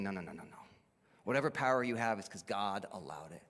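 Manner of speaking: very low, soft rebuttal; acted hushed authority; quiet correction. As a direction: calmly wave the claim away, voice dropped low. Acting Jesus calmly correcting Pilate again.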